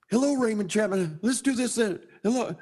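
high-pitched, excited retold hellos; eager greeting. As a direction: greet people as if suddenly switched on. Newly keen, greeting everyone.

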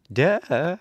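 put-on a child rolling her eyes at the lion; little girl's sarcastic duh. A narrator's reading. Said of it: "replied Dorothy".

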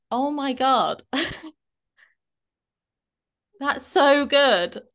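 high, slow gushing praise; drawn-out delighted "so good"; excited praise. Retold or imitated. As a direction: gush over her result. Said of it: "I said to her".

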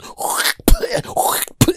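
shrill unvoiced wheeze of a laugh; squeaky sneaky giggle from siblings; high wheezing cackle. Siblings spitting in food, high wheezing cackle.

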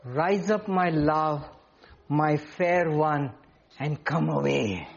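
tender call. Acted. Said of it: "My beloved spoke and said to me".

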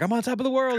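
high, mock-triumphant cry; imitated elation. Andy on a bike celebrating his win, high imitated elation. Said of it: "Andy freaks out".

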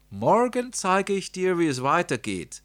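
high, loud aunt voice; storybook aunt. A narrator's reading. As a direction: call it out as the aunt, pitched well up. Aunt's promise, voiced in story.

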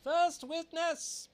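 high, slow herald's call. A narrator's reading. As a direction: announce it across the court. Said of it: "called out".

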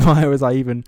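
ordinary talk, nothing marked

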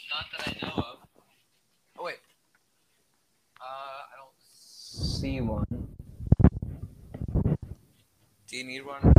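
speaking off-mic to someone else; turned aside, talking to mom; turning away from phone. Real, not acted. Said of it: "Sorry, that was my mom".

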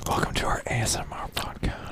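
soft breathy ASMR whisper. Pretend. ASMR parody intro.

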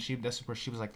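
plain reading voice

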